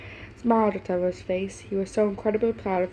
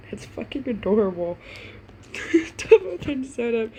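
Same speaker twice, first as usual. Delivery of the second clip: laughing; 4 semitones higher than usual. Real, not acted. Reader gushes, laughing.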